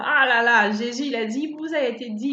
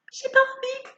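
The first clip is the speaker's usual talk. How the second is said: high, whining reluctant voice; whiny; 12 semitones higher than usual. Retold or imitated. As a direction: whine it like a sulky child.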